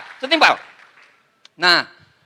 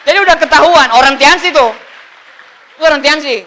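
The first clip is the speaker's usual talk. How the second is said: mock exposé; 4 semitones higher, 7 dB louder than usual. Real, not acted. Exaggerated comic reveal.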